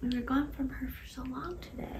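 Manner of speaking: whispering. Real, not acted. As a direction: hush it, barely breathing the words. Whispering beside the sleeping baby.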